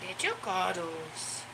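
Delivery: tender pet talk. Real, not acted. Cuddling the dog.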